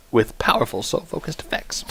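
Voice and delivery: soft, breath-heavy undertone; quiet repeat. Real, not acted. Streamer re-reads the ability to himself, quiet undertone. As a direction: drop to a near-whisper, as if to yourself.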